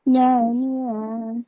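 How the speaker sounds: ordinary talk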